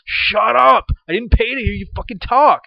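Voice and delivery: high, loud shout of fed-up rage; furious shout. Acted. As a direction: yell it at the talkers in the theater. Ranting at talkers.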